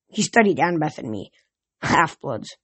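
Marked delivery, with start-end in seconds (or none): 1.8-2.6 low grunted word. A narrator's reading. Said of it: "he grunted".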